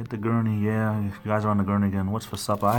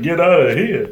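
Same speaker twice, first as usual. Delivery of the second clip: much higher and louder, hammed-up disbelief; mock incredulous shout; 8 semitones higher, 11 dB louder than usual. Retelling his reaction to the accent remark, mock shout. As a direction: scoff it out like a big joke. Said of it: "I'm like".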